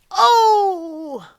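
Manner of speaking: loud, high, drawn-out "oh"; hammed-up cry of dismay; mock wail. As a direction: wail a long, high "Oh!" imitating his reaction. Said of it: "he goes".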